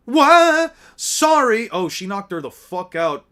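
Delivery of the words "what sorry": loud, high yelp of surprise; acted shock, pitched up and loud; startled outburst. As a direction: blurt it out, caught off guard. Startled character blurts an apology.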